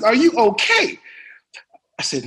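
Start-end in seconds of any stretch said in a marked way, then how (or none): 0.0-1.0 high, loud mock scolding; Sam Jackson. Star correcting a nervous young actor, exasperated. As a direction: imitate an exasperated star telling someone off. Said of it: "He said".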